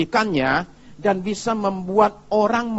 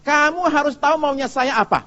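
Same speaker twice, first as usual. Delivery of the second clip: demanding wife; 9 semitones higher, 3 dB louder than usual. Retold or imitated. Wife demanding in acted quarrel.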